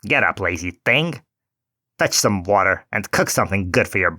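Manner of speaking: lightly voiced witch ordering Gretel about; a hint of a cruel old witch; scolding witch. A narrator's reading. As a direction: bark orders at the lazy girl. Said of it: "and cried".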